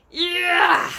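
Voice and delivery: huge, high, loud roar. Acted. Bloodbottler roaring at the vile taste. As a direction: bellow it like a giant. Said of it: "roared the blood bottler".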